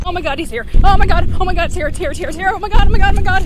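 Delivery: frantic terrified repeating; scared out of their mind; panicked. Real, not acted. Creature appears, frantic panic.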